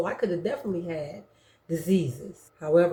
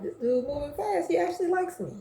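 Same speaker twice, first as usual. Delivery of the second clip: surprised inner voice; 11 semitones higher, faster than usual. Retold or imitated. Woman surprised the guy likes her, high. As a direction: gasp it to yourself, delighted.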